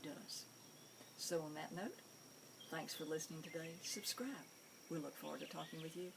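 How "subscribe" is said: soft whispered aside; whispered plug; whispered subscribe. Real, not acted. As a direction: breathe the word out. Aside during sign-off.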